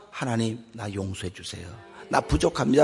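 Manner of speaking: soft, low-voiced prayer of repentance; hushed prayer. Pastor voicing a believer asking God's forgiveness, hushed. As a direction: pray quietly, head bowed.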